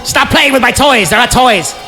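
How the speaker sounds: normal talking voice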